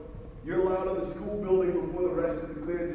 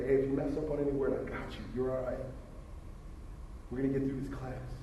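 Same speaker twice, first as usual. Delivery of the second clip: hushed and softer, close to the ear; retold whisper; 5 semitones lower, 7 dB quieter than usual. Teacher reassuring nervous [name] in his ear, hushed softly. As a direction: murmur it like a secret reassurance. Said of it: "he whispered this in, in my ear".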